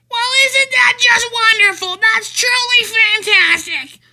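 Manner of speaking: very high, loud syrupy praise; over-the-top patronizing coo; mocking gushing stranger. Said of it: "his machine said it".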